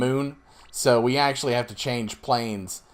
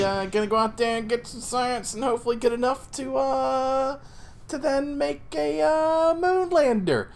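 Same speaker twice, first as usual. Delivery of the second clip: voice climbing high; 12 semitones higher than usual. Real, not acted. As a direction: pitch creeping up to very high. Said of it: "my voice is getting so high".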